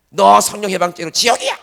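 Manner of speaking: 크게 호통치는 흉내; 댓글로 욕하는 사람을 크게 흉내; mock angry scolding. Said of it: "막 욕을 해놨어". (비판 댓글에 화내며 호통치는 사람 흉내)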